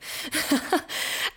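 short airy high laugh; a quick breathy giggle. Real, not acted. Laughing at own joke.

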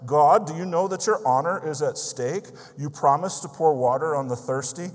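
loud, high, pleading cry of challenge; elder crying out to God at a farmhouse prayer meeting, loud and pleading. Retold or imitated. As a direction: call out to God as if his honour is at stake. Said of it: "he cried".

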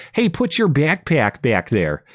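slow imitated Midwestern flight attendant; Midwestern accent. Flight attendant talking to a little girl, mock Midwestern accent. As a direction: mimic a Midwestern "byack-pyack", drawn out. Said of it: "there's this weird way that, where they say".

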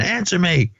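high-pitched retold demand, no real volume; mock yell. Principal demanding an answer from the singers, mock yell. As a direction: imitate a yell at normal volume. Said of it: "He just starts yelling".

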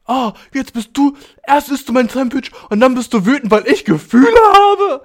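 viel höher und lauter, empört; gespielte Empörung. Retold or imitated. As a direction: die Freundin spielt sich empört auf. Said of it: "wie wütend er war". (schrill empört, viel höher und lauter)

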